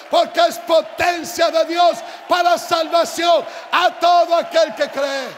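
loud, high, insistent shouting; full-force preaching. Real, not acted. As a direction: drive it home at full preaching volume.

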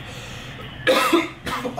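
forced fake cough. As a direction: cough to sound sick. Fake cough on prank call.

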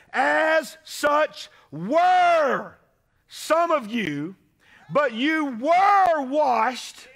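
high, loud, slow shouted scripture; fiery preaching. Acted. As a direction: thunder Paul's words out to the congregation. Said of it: "Paul says".

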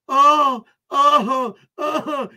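hammed-up fake sobbing oh oh oh, high and loud; mock crying. Streamer mocking a troll as a crybaby, fake sobbing. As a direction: fake a whiny boo-hoo to mock him.

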